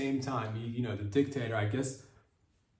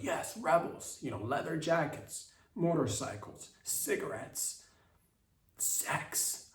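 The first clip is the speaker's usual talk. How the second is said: breathless fantasy; 5 semitones higher, slower than usual. Retold or imitated. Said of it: "I got excited 'cause I'm like".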